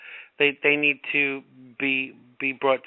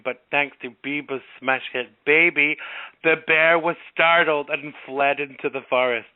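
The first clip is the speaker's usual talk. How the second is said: ordinary delivery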